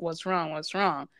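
mild mock of impatient badgering; impatient nagging. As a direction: imitate mom nagging "What's wrong? What's wrong?" twice in a row. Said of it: "and you just be like".

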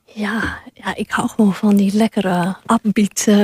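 hoarse, half-whispered voice; croaky throat, barely voiced; frog in throat. Real, not acted. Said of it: "ik kikker in mijn keel".

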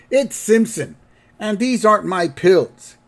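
put-on high, indignant old-man voice; cranky grandpa. Grandpa correcting the nurse, high and cranky. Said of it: "And Grandpa goes".

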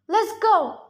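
high, urgent call to friends; urgent yell. A narrator's reading. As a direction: shout them into moving. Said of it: "I yelled at my friends".

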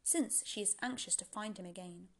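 ordinary delivery, nothing marked

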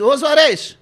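loud, high hail across a shop; calling out. Retold or imitated. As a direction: holler the name to get his attention. Calling pharmacist in crowded shop.

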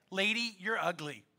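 hint of a deadpan parrot; lightly voiced parrot. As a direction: deliver the insult as a parrot, softly and dryly. Parrot insulting lady in joke.

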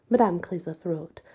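usual speaking voice

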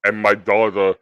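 lumber through it like a huge wrestler; Andre the Giant. Pretend. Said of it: "It's called Andre the Giant".

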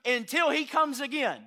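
loud, high, ringing sermon delivery; full-force preaching. Acted. Pastor preaching at full force to the congregation. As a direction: preach it to the rafters.

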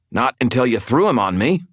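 testy comeback; snapped retort. A narrator's reading. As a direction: snap back at them, grumpy. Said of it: "I snapped".

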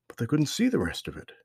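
ordinary delivery

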